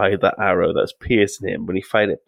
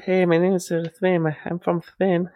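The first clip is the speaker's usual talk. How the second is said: high, put-on Spanish accent; lightly hammed Spanish accent voice; mock Spanish accent; 8 semitones higher than usual. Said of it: "if I was to do".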